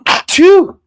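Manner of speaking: sudden loud sneeze. Real, not acted. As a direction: sneeze hard into the mic. Sneeze on air.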